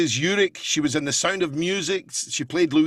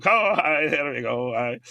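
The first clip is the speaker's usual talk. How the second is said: sing-song impression; 2 semitones lower than usual. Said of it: "a wee kind of sing song every time he spoke".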